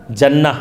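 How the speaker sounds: جنہ said "janna", with a short a; "a" vowel in جنہ. Shown as a demonstration. Teacher lists the three readings for students, جنہ as "janna". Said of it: "تینوں کے ساتھ".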